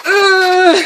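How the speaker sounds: loud, high straining "ugh"; effort grunt. Real, not acted. Vlogger scribbling violently on a page, strained grunt. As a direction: grunt from pushing hard on the pen.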